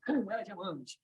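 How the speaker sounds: soft, dropped-down repeat; low echoed response. Acted. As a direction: say it privately to one neighbour. Participant's echo of the command.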